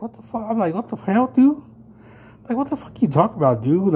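high, incredulous retold yell at the stranger; retold outburst. Retelling the restroom confrontation, high incredulous outburst.

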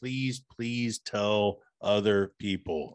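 slow, drawn-out pleading; begging. Real, not acted. Podcast guest asking fans to spread the word, begging. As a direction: stretch out the plea, word by word. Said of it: "now you sound like you're begging".

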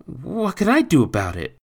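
put-on high, loud child's question; anxious boy. A narrator's reading. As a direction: ask it helplessly in a high child voice. Boy answers with knotted stomach.

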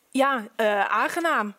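ordinary delivery, nothing marked

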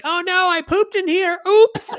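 high, loud fake-innocent voice; mock innocent. Kid pretending he didn't mean the prank, high and loud. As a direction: play a squeaky little kid feigning surprise.

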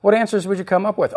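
ordinary talk, nothing marked

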